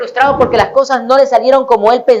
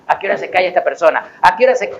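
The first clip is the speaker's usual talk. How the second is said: exasperated muttering; 7 semitones lower, 4 dB quieter, faster than usual. Retold or imitated. Mocking a complaining person.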